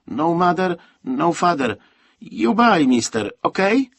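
put-on high, loud pleading child; eager little street seller, high-voiced; boy's broken English. A narrator's reading. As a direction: pitch each short phrase up insistently. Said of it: "posługując się niewielką liczbą słów angielskich".

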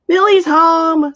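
high, loud, slow parrot squawk. Acted. Parrot announcing the dragon's arrival, loud high squawk. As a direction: squawk it out like an excited bird. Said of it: "squawked the green and yellow parrot".

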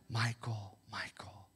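hushed whispered name; whispered call. Pretend. God whispering a listener's name.

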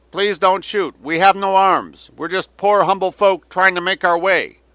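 loud, high, booming propaganda call; roaring loudspeaker voice. A narrator's reading. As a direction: roar it across a river. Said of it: "The roaring voice rolled across the river".

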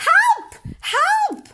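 very high, loud yell for help; panicked bellow. A narrator's reading. Bear yelling for help at the burrow, high and loud. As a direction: bellow in fright. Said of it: "bellowed Bear".

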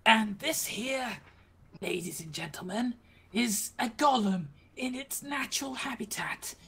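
nature documentary. Pretend. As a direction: narrate like a wildlife film presenter. Mimicking wildlife narrator.

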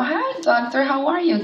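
high, cheery hello to the doctor; bright greeting. Retold or imitated. Greeting her doctor on the phone, cheerfully high. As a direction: answer the phone all cheerful.

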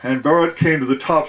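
ordinary delivery

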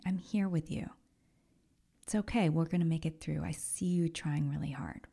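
soft, whispered comfort; loving inner voice whispering comfort; tender whisper. Pretend. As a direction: breathe it out gently, like soothing a struggling friend. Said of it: "the one that says".

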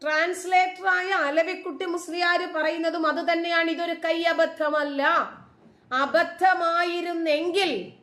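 high-pitched, emphatic insistence; insistent rebuttal. Real, not acted. Vlogger citing the translator to viewers, insistent.

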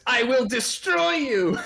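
slow, high menacing villain quote; villain voice line. Retold or imitated. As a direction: boom it like a game villain. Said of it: "a solid voice line".

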